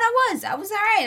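high, indignant insisting; protest, voice jumping up high. Real, not acted.

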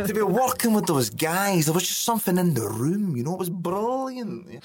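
Billy Connolly. Acted. Older self recalling the cast, mimicked Connolly voice. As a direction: swoop up high and stretch the words fondly. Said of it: "That's how I want to talk".

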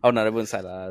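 usual speaking voice

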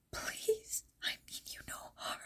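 frightened breathy whisper, barely audible; shaking whisper. A narrator's reading. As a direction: plead softly, afraid to startle the beast. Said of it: "at her shaking whisper".

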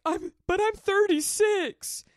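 high, slow pleading whine; squeaky mock-panicked protest; whiny protest.

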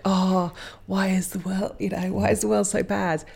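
hammed-up woe-is-me wail; mock devastated. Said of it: "devastated and like".

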